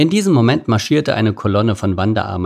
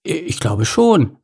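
hesitant, slow and faltering; small voice, uncertain; a bit unsure; 3 semitones higher, slower than usual. A narrator's reading. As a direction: lose confidence mid-answer. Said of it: "ein bisschen verunsichert".

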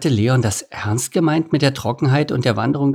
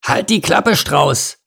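raised pitch, annoyed order; irritated grumble; 7 semitones higher, slower than usual. A narrator's reading. Rhino silencing the chattering ostrich, irritated. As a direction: tell them off irritably. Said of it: "brummte Lord Nase gereizt".

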